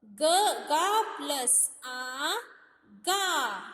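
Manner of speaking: slow demo. Shown as a demonstration. Teacher showing children how ख is built, slowly. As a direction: say ख plus अ very slowly, each part apart. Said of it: "इसमें आ का आवाज आ रहा है".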